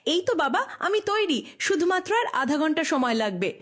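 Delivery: distant and soft, called from another room; from the next room. Acted. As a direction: answer from the far side of a wall. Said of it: "পাশের ঘর থেকে উত্তর দিল". (পাশের ঘর থেকে দূরের গলায় উত্তর)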